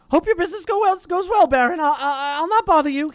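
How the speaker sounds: high, loud oily apology; greasy grovelling poltergeist. Acted. Peeves grovelling before the Bloody Baron, high loud oily voice.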